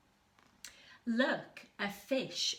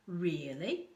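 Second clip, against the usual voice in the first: ordinary delivery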